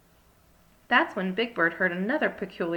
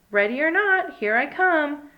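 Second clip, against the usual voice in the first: loud sing-song call, pitched high; playful high calling voice; 8 semitones higher, 7 dB louder, slower than usual. A narrator's reading. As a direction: call out to hidden friends across the camp. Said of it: "Big Bird called".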